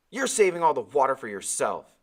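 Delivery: loud accusing yell. Acted. Boy yelling at Timothy, accusing. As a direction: shout the accusation across the raft. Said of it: "I yelled over at him".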